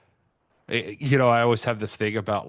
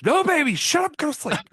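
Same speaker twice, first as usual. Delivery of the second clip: very high, loud silly voice; mock grumpy Mike; 5 dB louder than usual. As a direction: ham up a cranky guy telling the baby off. Mocking Mike talking to baby.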